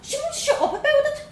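put-on high, bold Dublin girl voice; young Dublin girl. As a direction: play a cheeky little Dublin girl. Vanessa complains.

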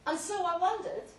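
booming tale told through a loud hailer; grand loud speech. Acted. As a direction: hold forth like a host at a banquet. Speech to guests via loud hailer.

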